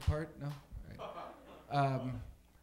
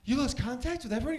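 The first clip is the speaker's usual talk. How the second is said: high, loud, disbelieving echo; Mark Wahlberg; 8 semitones higher, 8 dB louder than usual. Acted. Comic as movie hero repeating the crew's answer, high astonished impression. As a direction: parrot it back as an astonished movie actor. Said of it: "here's my impression of Mark Wahlberg".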